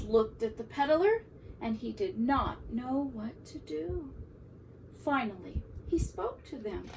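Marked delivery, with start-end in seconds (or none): none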